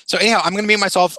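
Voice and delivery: ordinary talk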